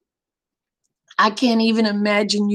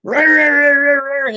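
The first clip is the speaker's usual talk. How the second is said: mock rant noises, louder and higher; hammed-up ranting gibberish; imitating husband's ranting; 4 semitones higher, 5 dB louder than usual. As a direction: babble like a ranting man. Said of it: "And he just".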